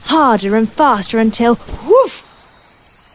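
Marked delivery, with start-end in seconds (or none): 1.9-3.1 sharp bark. A narrator's reading. Dog's sound in story.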